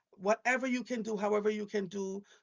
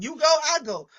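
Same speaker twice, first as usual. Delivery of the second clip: loud, higher, firm declaration; emphatic husband; 7 semitones higher, 11 dB louder, faster than usual. Retold or imitated. Husband insisting he goes wherever she goes, loud and firm. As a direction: say it loud, like a pact. Husband's line voiced loudly.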